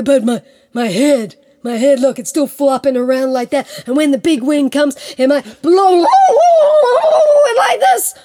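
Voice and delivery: blustery, hammed-up pleading; anxious tiger. Plays Tiger begging.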